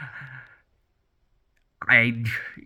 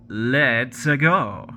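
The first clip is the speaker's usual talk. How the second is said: imitating Chris Pratt. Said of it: "he'll say, like".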